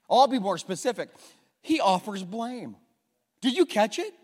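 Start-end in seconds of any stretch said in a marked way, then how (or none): none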